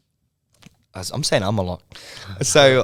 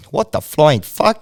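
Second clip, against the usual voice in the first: slow, louder mock outrage; 5 semitones higher, 8 dB louder, slower than usual. Yelling at Ben about the broken lighting, slow mock outrage. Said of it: "and I'm like".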